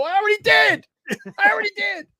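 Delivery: high-pitched mock defiance; defiant retort. Host answering back to his bosses, defiant. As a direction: fire back with voice pushed way up.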